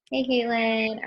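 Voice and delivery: ordinary talk, nothing marked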